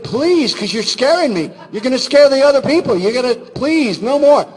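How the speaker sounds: high, loud mock pleading; pleading squeaky voice. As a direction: beg in a cartoonish high voice. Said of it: "So he talked like that".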